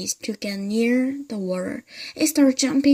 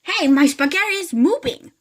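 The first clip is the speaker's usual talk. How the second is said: much higher, loud startled shout; alarmed cry; 11 semitones higher, 8 dB louder than usual. Acted. Mr Twit crying out at his wriggling spaghetti. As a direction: cry out in shock. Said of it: "cried Mister Twit".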